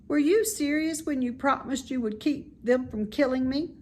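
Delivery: put-on bright childlike pig, fond of the barn; high, eager young pig; young pig Wilbur. Wilbur voiced higher.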